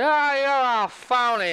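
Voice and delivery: put-on high, drawn-out drunken heckle; jeering drunks. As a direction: stretch it out like an outraged barfly. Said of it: "And all the drunks are".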